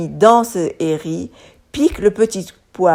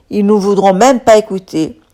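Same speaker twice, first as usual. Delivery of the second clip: ordinary delivery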